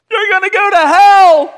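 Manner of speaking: very high, loud mock-child scream; hammed-up panicked shout; frantic retold yell. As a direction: scream a warning at your little brother. Child yelling warning at little brother.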